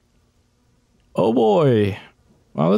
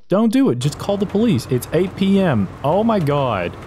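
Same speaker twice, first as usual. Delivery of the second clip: normal talking voice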